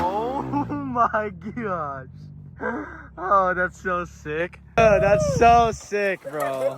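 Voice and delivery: slow, drawn-out amazement; amazed awe. Real, not acted. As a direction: stretch it out in awe. Watching a friend's jump.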